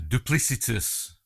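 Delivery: duplicitous drawn out slow, loud and high; stress on duplicitous. Real, not acted. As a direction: lean hard into duplicitous, slowly. Word savored in film commentary.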